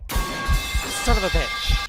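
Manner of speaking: drawn-out frightened curse; startled yell. Real, not acted. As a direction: yell it in shock. Ghost attack jump scare.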